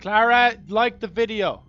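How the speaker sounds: high, loud nagging call; mock-stern shout. Streamer ordering Clara to like the video, mock-stern shout.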